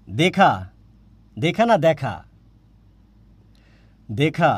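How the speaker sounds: দেখা said with an open æ, like dækha. Shown as a demonstration. Teacher to students, saying দেখা with an open vowel. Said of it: "কিন্তু এটার উচ্চারণ হচ্ছে দেখা".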